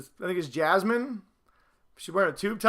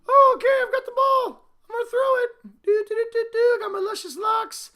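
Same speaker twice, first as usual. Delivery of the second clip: much higher, a mocking dainty put-on voice; prissy quarterback. Vlogger mocks NFL quarterbacks with a doll, high dainty mock voice.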